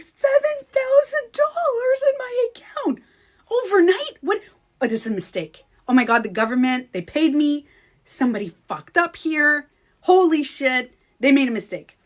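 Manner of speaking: high, loud, disbelieving excitement; screaming it out in shock at the money; retold freak-out. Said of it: "I'm like".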